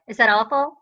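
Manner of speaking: ordinary delivery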